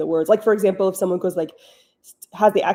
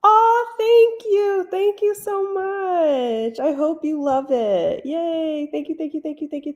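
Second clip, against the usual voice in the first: gushing thanks; 9 semitones higher than usual. Real, not acted.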